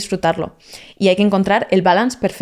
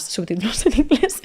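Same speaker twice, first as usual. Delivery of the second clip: laughing repeat; 3 semitones higher, 3 dB quieter than usual. Real, not acted. Host repeating her tip a third time, laughing. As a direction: laugh through the middle of subtítulos en inglés, voice a touch altered. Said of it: "Y lo digo otra vez más".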